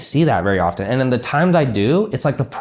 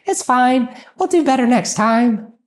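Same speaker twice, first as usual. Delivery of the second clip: high, breezy shrug-it-off voice; mock easygoing boss; 11 semitones higher, slower than usual. Mocking a lax owner to a client, high and breezy. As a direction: toss it off like nothing matters. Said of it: "if you're just always like".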